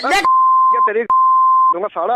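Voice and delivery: real angry shout, loud and high; furious threat. Real, not acted. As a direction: explode at the pushy caller. Pranked customer threatens the salesman.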